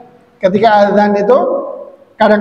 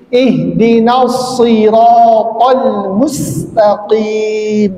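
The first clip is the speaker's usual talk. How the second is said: ihdinas siratal mustaqim slowly; slow and deliberate, sod then sin; slow recitation; much slower than usual. Shown as a demonstration. Teacher contrasts sod and sin in the verse. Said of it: "yang satu sod".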